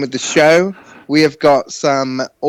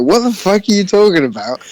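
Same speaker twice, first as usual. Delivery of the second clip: baffled outburst; 5 semitones higher than usual. Retold or imitated. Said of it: "And I'm like".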